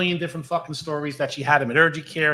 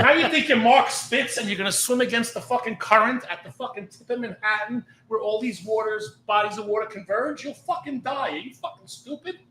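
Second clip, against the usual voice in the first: exasperated rant; 8 semitones higher, 4 dB louder than usual. Retold or imitated. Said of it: "I said".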